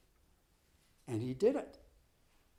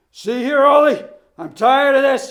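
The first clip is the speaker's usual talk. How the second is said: shouting; 8 semitones higher, 14 dB louder than usual. Acted. Coach yelling at runner.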